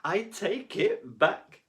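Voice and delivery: deputy head taking it back, slow and loud; sorry deputy head. Acted. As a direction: beg forgiveness like a tearful head. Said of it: "said Mister Jed, who shed a tiny tear".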